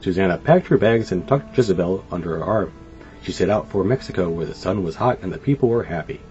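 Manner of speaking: usual speaking voice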